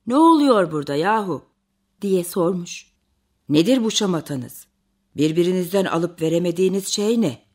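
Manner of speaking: low, firm storybook bear voice; big bear stepping into a quarrel; puzzled Tonton bear. A narrator's reading. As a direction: question the squabblers in a deep bear voice.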